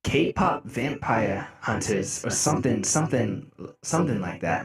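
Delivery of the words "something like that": ordinary talk, nothing marked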